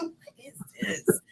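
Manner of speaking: breathless whispered question; whispered what-is-this at the dark cabin; hushed whisper. Retold or imitated. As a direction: whisper it like you're scared to be heard. Whispered reaction in the woods.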